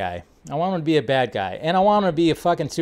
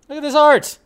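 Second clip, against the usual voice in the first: higher, louder admiring exclamation on "art"; excited; 10 semitones higher, 4 dB louder than usual. Real, not acted. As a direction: Look at this art, with "art" thrown up high and louder.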